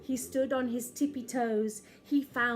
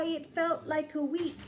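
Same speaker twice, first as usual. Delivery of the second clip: plain reading voice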